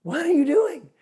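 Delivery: a mock exasperated challenge rising to a shout; annoyed doorman. Doorman scolding the pastor for cutting the line, rising to a shout. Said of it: "the guy in the very front who was at the door goes".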